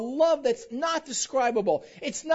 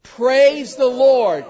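loud, drawn-out Praise the Lord; loud slow praise; 11 dB louder, much slower than usual. Shown as a demonstration. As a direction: call it out slowly. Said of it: "that one phrase was what".

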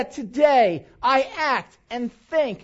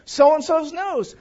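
ordinary delivery

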